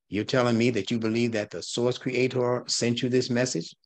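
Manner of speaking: ordinary talk, nothing marked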